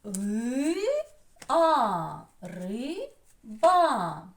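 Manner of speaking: "Ри-а" as two separated long vowels, then "Риба" likewise; loud, drawn-out sounding out of "Ри-а", "Риба"; syllable by syllable. Shown as a demonstration. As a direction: stretch each syllable of "Риба" with a gap between. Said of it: "теж два склади".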